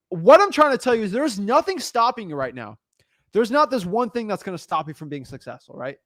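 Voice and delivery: normal talking voice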